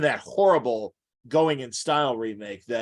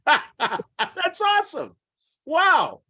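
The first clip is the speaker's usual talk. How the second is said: laughing; 5 dB louder, much slower than usual. Real, not acted. Delighted reaction to Caine story.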